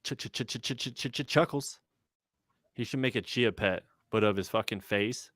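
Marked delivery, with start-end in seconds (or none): 0.0-1.8 stutter-echo name. Pretend. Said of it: "We have Chuckles in the chat".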